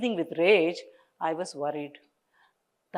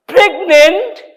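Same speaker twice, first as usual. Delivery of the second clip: even higher, louder "Pregnant?"; rising disbelief, louder second time; louder shocked question; 10 semitones higher, 12 dB louder than usual. A narrator's reading. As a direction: repeat it, more stunned.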